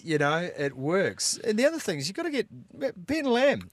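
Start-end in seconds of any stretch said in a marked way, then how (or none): 1.4-3.7 excited. Real, not acted. Excited call for Ben Lamb.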